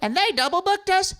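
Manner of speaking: high, loud, stretched-out complaint; imitating an outraged local musician; aggrieved heckler. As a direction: holler it as a mock tantrum. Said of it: "And he goes".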